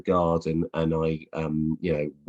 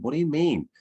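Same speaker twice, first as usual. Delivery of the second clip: puzzled publisher; 8 semitones higher than usual. Retold or imitated. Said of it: "they said".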